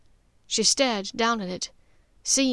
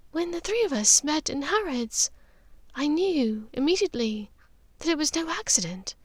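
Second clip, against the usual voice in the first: confiding Narcissa; 7 semitones higher than usual. A narrator's reading. Narcissa voiced confession.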